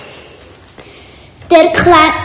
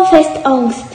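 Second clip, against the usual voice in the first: usual speaking voice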